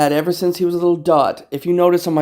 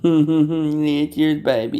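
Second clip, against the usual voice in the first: usual speaking voice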